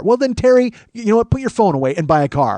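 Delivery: loud, high comic exasperation; hammed-up fed-up outburst; exasperated rant. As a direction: dismiss him, fed up, building to a yell. Fed-up reply to carless Terry.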